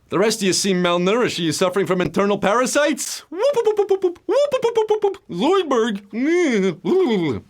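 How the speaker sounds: a self-admittedly rough impression of the cartoon alien lobster; Zoidberg impression. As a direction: play the panicky alien doctor, squealing the whoops. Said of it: "That's my Zoidberg impression".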